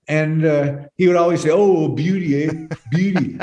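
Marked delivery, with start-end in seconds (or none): 1.5-3.4 lightly mock Canadian Beauty, eh?; a playful hint of a Canadian hoser accent. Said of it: "He's a Canadian guy".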